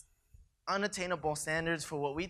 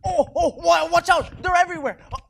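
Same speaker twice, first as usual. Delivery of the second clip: high panicked battle yelling; cover me shout; 11 semitones higher, 9 dB louder than usual. Acted. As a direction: scream it like you've been hit. Acted battle, shot down.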